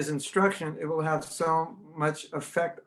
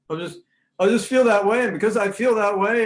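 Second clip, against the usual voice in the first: higher, louder mock insistence; raised voice of someone arguing from pure feeling; insistent mock sentimentalist; 6 semitones higher, 6 dB louder than usual. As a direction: insist on your feelings, hammed-up.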